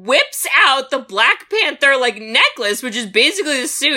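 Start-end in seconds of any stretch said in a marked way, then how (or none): none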